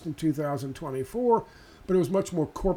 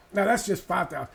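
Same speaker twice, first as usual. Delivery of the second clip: usual speaking voice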